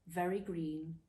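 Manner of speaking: ordinary delivery, nothing marked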